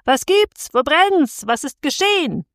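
high-pitched startled questions, each jumping up; alarmed cries. A narrator's reading. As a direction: cry out as if roused by an alarm at night. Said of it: "und alle schrien".

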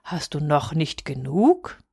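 low, slow, softly voiced question; admonishing old moon. A narrator's reading. Old moon asks boy to stop, slow admonishing. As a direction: ask slowly and low, as the patient moon.

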